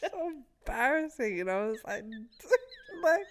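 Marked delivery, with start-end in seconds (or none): 2.0-3.3 high loud words through laughter; tearful laughter; crying with laughter. Real, not acted. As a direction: squeak it out laughing. Laughing helplessly.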